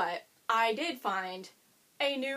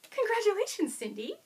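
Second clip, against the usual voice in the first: high bright cheer to the winner; excited congratulations; 11 semitones higher, 4 dB louder than usual. Real, not acted. Vlogger congratulating giveaway winner Cindy, excited cheer. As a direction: announce it like a prize.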